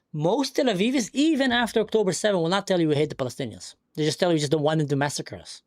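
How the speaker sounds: ordinary delivery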